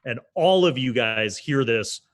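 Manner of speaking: normal talking voice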